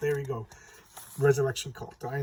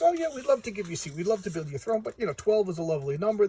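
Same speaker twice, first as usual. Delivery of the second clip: lightly voiced, higher, fast, falsely sweet queen; mock sweet Hera; 6 semitones higher, 3 dB louder, faster than usual. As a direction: imitate a sugary queen turning him down politely. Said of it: "She goes".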